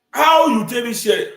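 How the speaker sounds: high, loud incredulous question; loud demand. Acted. Commentator confronting Obi over getting here, loud demand. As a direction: throw the question out loudly. Mock confrontation, raised again.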